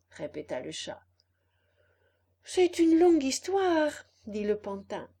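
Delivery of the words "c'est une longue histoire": high, loud puppet voice; eager puppet. Acted. Puppet starts his story for fox and cat, eagerly. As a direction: pitch it up and project, like an eager puppet.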